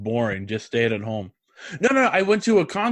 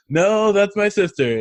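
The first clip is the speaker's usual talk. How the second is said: high, louder retold protest; mock protest; 7 semitones higher, 5 dB louder than usual. Fan correcting the musician about sister, mock protest. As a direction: protest it with raised pitch.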